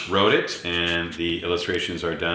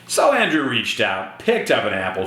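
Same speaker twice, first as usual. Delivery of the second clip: plain reading voice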